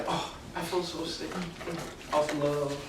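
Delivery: soft, breathy, put-on lovesick groan; fake sick moan. Amnon faking illness in bed, a mock feeble moan. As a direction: fake being sick with love. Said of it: "then he's like".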